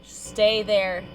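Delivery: ordinary delivery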